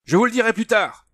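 high, loud hurried yell; urgent high shout; shouting over clamour. A narrator's reading. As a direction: make yourself heard over the din. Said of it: "en essayant de se faire entendre par-dessus la clameur".

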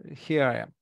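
ordinary talk, nothing marked

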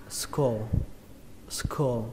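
"skull" said as "skô", vowel rounded to O. Shown as a demonstration. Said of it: "mà các bạn đọc luôn âm ô". (đọc "skull" với âm ô)

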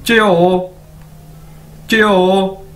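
च्यो one gliding syllable; not चियो but च्यो; right pronunciation. Shown as a demonstration. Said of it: "चियो नहीं कहना इसको".

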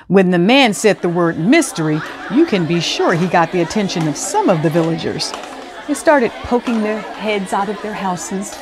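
ordinary delivery

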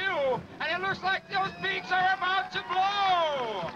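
booming showman announcer. Acted. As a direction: sell it to the crowd like a showman. Live water-ski show announcing.

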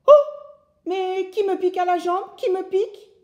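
high, loud howl of pained surprise; woodcutter howling. Acted. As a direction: yelp as something stings his leg. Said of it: "le bûcheron hurle". (hurlement aigu du bûcheron piqué)

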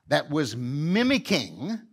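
normal talking voice

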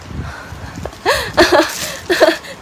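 breathy burst of laughter; laughing. Real, not acted. Seller amused on her livestream, laughing.

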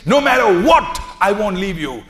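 high loud cry on no matter what; fervent cry. Acted. Ruth refusing to leave her mother in law, a loud high cry. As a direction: fling it out as an unbreakable promise.

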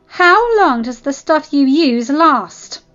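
loud, high, drawn-out angry question; barked demand. Acted. Claudine questioning the enemy Zevon, barking. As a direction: demand an answer. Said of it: "Claudine barked at Zevon".

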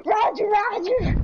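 high, perky catchphrase; droid catchphrase. Pretend. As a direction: pipe it up high, playfully. Repeating droid catchphrase in play.

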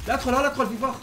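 high, loud warning; urgent shout. Real, not acted. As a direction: stop him from walking in. Warning teammate of a trap. (صرخة تحذير)

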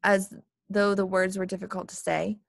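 plain reading voice

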